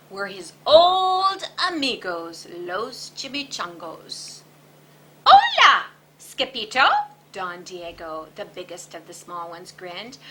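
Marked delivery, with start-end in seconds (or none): 5.2-7.1 high, loud, gleeful hello; excited greeting. A narrator's reading. Chihuahua greeting returning Skipito, loud and gleeful. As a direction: call out to an old friend with joy.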